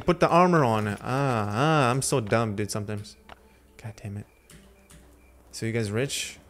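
normal talking voice

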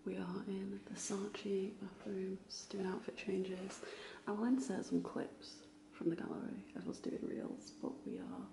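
hushed whisper in a bathroom. Real, not acted. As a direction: whisper so nobody hears.

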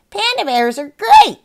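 high, loud squawky parrot snicker. Acted. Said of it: "snickered right back".

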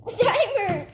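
loud, high laughter; laughing shout. Real, not acted. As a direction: burst out laughing. Laughing fit over candy.

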